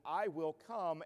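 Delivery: firm promise, stress the "I"; Jesus promising the centurion firmly; emphatic. Acted. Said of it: "he says to the centurion emphatically".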